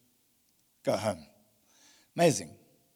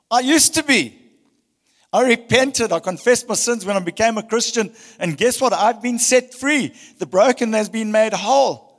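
louder, lifted preaching voice; rising preaching; 6 semitones higher, 8 dB louder than usual. Acted. Pastor telling his own redemption, lifted fervent preaching. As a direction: testify with mounting conviction. Testimony of being set free.

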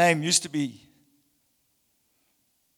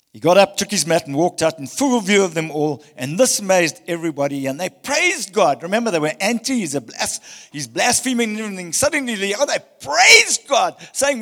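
louder, building fervent preaching; climactic preaching; 3 semitones higher, 7 dB louder than usual. Acted. Pastor telling the healed man walking out, building loud preaching. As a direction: drive the story to its climax.

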